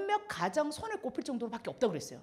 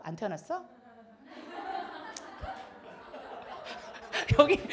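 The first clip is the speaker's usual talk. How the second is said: ordinary talk, nothing marked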